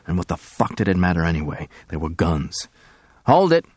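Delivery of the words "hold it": commanding. Acted. Gunman stopping the crowd.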